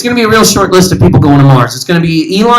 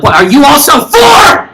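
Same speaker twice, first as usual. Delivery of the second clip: mock shout; 8 semitones higher, slower than usual. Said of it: "I'm like".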